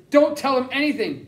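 yell. Acted. Jack warning his brother not to talk, yelling. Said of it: "Jack yelled at me".

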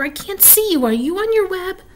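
high-pitched anxious questions; puzzled young pig. A narrator's reading. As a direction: ask high, searching for a friend. Wilbur searching for Charlotte.

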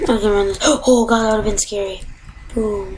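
normal talking voice

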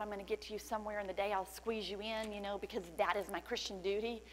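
ordinary talk, nothing marked

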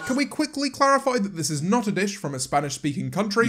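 ordinary talk, nothing marked

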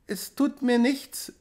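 put-on high, slow, timid child's voice; a frightened little girl, pitched high; trembling child. A narrator's reading. Child reassuring herself before the sea, high and trembling. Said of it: "sagte sie zitternd".

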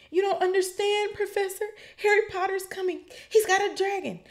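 very high, loud desperate whine; desperate Malfoy. A narrator's reading. Malfoy begging McGonagall to believe him, shrill high pleading. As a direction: shriek it trying to get Harry caught.

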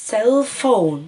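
teacher repeating cell phone to count syllables; syllables marked. Shown as a demonstration. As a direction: tap out cell phone in two beats. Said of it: "هعمل كده وابتدي اقول".

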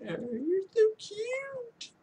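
cooing at a puppy, very high and slow; very high, slow doggy baby talk. Pretend. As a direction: coo it at the dog like it's a baby. Cooing at a dog.

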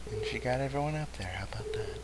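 whisper. Real, not acted. Whispering while sneaking.

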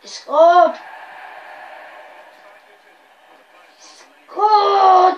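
loud, high, stretched shout; shouting the goal. Real, not acted. Gamer reacting to a goal, loud shout.

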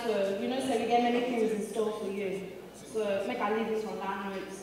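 ordinary talk

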